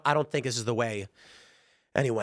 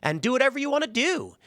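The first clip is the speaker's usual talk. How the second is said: much higher, sugary encouraging voice; mocking young woman; 9 semitones higher than usual. Young woman in the game encouraging the player, mocked in a high voice. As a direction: parody a gentle cheerleader. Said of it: "she's like".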